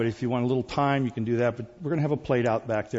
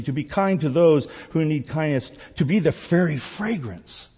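higher, louder preaching in parallel phrases; rhythmic preaching; 4 semitones higher, 6 dB louder than usual. Real, not acted. Preacher telling the congregation their calling, rhythmic preaching. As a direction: roll through the list with rising fervour.

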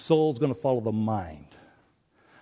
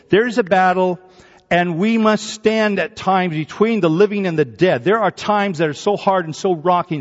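loud, driving sermon climax; rally the church; preaching at full force; 6 semitones higher, 8 dB louder than usual. Acted. Preacher urging the congregation to stand firm, loud.

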